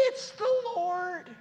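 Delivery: high, loud, slow cry of recognition; awed cry. Acted. Beloved disciple recognizing Jesus on the shore, awed cry. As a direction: cry out in awe.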